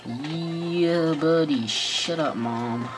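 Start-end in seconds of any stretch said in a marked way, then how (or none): none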